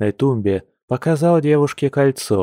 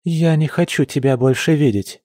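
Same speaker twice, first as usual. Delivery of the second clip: plain reading voice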